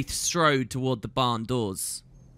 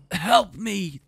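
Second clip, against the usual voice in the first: sputtered; 6 semitones higher, 4 dB louder than usual. A narrator's reading. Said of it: "the man sputtered".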